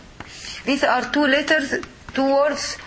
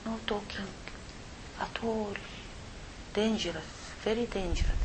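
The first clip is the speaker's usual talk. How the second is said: much softer, hushed warning; 3 semitones lower, 16 dB quieter than usual. Real, not acted. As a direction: hush it down, we're in the masjid. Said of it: "We are in the masjid".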